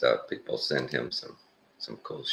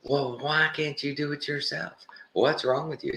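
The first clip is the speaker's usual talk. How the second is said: high, taunting mock-berating voice; 13 semitones higher than usual. Man berating a homeless guy who wants a phone, taunting. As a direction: sneer it out like a jerk rubbing it in. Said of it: "He just berating the guy".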